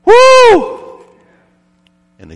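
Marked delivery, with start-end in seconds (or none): 0.0-1.0 very high, loud whoop; long high whoop to catch someone's attention; hollered "woo". Acted. Shop worker hollers to passing girl.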